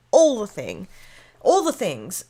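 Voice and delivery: normal talking voice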